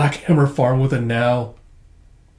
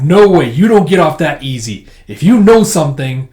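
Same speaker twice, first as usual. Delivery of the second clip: loud, indignant objection; acted forceful challenge; insistent protest; 3 semitones higher, 9 dB louder than usual. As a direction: refuse to let them slip away. Lucy refuses to let him go.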